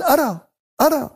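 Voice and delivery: يعني "أرى" said twice in a high, rising voice; high mock voice. Preacher mimicking a listener claiming to see, high-pitched. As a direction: pitch "أرى" up high, like someone feigning insight.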